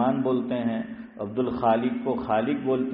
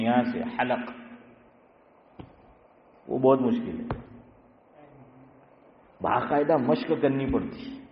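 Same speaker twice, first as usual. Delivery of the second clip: ordinary talk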